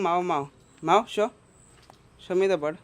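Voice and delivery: loud, high call to the cat. Real, not acted. Owner calling loudly to his cat. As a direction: call out to the cat across the room.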